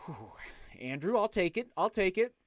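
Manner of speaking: usual speaking voice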